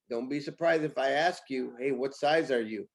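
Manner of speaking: ordinary delivery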